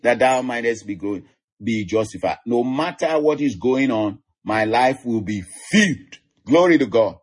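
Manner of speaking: ordinary talk, nothing marked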